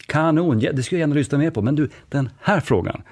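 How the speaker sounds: bubbly, fast, eager colleague; upbeat yellow enthusiasm. Retold or imitated. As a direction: gush along then nudge onward. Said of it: "kommer jag osökt att tänka på".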